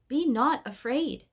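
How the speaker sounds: slowly and gently commanding, lifting the voice; lightly voiced angel calming the soldiers; angel's command. Angel calms the frightened soldiers.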